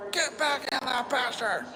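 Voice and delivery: high, slow yell after a runaway horse; mock yell at horse. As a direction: call it out like scolding a horse that already got past you. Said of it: "you yell at it".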